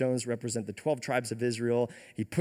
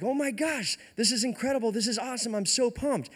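excited fanboy; 9 semitones higher than usual. Retold or imitated. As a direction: gush like a starstruck fan. Retold Obadiah thrilled to meet Elijah.

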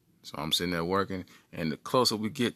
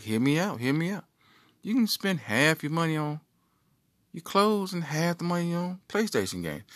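higher, persuasive brain voice; scheming brain; 7 semitones higher than usual. Acted. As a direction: sell the plan to himself. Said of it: "My brain like".

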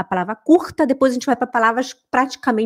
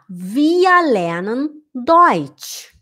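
slow example; much slower than usual. Shown as a demonstration. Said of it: "example read slowly".

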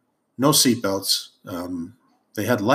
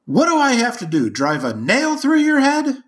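mock outburst from dad, much higher; exasperated dad; 4 dB louder than usual. Father fed up with his son running yellow lights, high mock outburst. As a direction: hurl the question like a fed-up father. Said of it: "finally turns to me and says".

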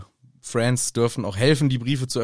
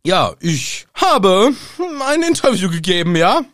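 mock defiant Harry; 4 semitones higher, 5 dB louder than usual. Mocking Harry's defiant answer.

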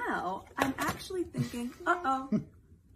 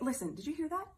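usual speaking voice

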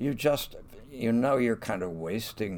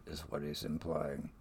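ordinary delivery

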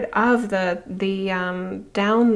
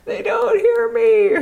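much higher, whining complaint, hammed-up; mock whiny complaint; 13 semitones higher, 4 dB louder than usual. Jesus complaining nobody hears him, high whine. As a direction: whine it like a tired teacher. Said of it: "And he's like".

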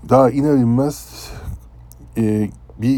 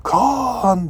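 very high, long screamed call; screamed name; 13 semitones higher than usual. Retold or imitated. Captain screaming for Kaan from the bridge, piercing scream. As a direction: scream the name across the deck. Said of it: "çığlıkla".

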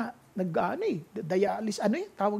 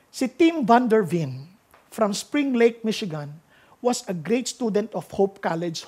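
normal talking voice

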